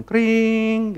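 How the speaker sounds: high sustained telephone ring; imitated phone ring. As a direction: draw out a high-pitched ring. Meniru dering telepon. (tiruan dering telepon yang panjang)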